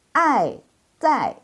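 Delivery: ai zai said high and drawn out; tone demo. Shown as a demonstration. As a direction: model zai after spelling it. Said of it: "Zai itu pinginnya zi".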